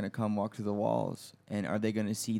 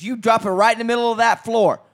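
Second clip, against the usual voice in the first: much higher, much louder mock furious mom; angry mother ordering; 9 semitones higher, 12 dB louder than usual. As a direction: bark orders like an enraged mother. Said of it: "She goes".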